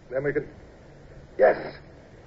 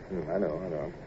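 quiet; 6 semitones lower, 11 dB quieter than usual. Acted. Rueful admission.

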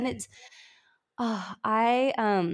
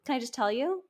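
ordinary talk, nothing marked

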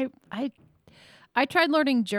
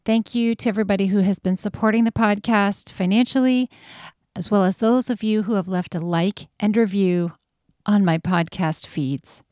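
podcast outro read; 8 dB louder than usual. Acted. Host thanking guests and listeners, in a louder announcer-style outro. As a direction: thank listeners and patrons like a show host closing.

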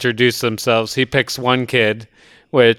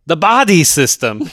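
put-on thick Austrian accent, pitched higher and insistent; 6 semitones higher, 4 dB louder than usual. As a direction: repeat "The body system." higher, in a heavy Austrian accent. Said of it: "his accent is perfect".

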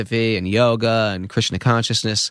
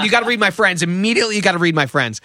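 urgent plea; 8 semitones higher, faster than usual. Retold or imitated. Urging palm reader, alarmed.

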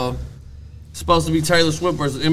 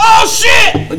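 surprised shout; 10 dB louder than usual. Real, not acted. Beer spilled on him.

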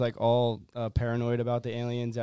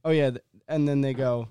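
normal talking voice

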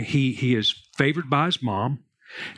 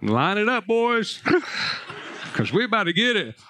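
laughing; 7 semitones higher than usual. Retold or imitated. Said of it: "I was like".

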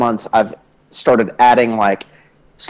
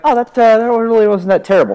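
high, relieved surprise; surprised; 8 semitones higher, faster than usual. Retold or imitated. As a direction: sound pleasantly surprised. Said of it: "I was like".

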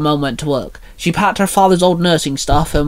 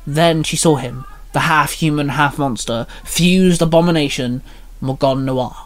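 ordinary delivery, nothing marked

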